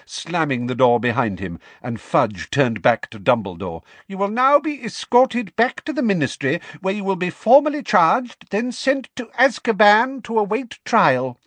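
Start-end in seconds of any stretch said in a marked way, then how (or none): 4.1-11.4 high, loud, triumphant decree; vibrating with joy. A narrator's reading. Minister sentencing the headmaster, joyful. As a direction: pronounce the sentence with gleeful relish. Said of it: "his voice still vibrating with joy".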